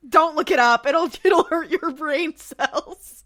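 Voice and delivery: loud warning breaking into laughter; slow, drawn-out words broken by real laughs; laughing warning. Real, not acted. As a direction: call it out, then laugh through it.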